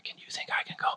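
imitating an intimidated fan's whisper; very soft, hushed aside; timid whisper. Fans too intimidated to approach a movie star, timid whispering.

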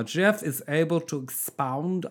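slow, deliberate mock German accent. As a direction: relish each word in a comic German accent. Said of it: "read that in German accent".

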